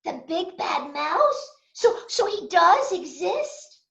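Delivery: suddenly unsure the mouse is real; startled little Gruffalo. Acted. Gruffalo's child speaking.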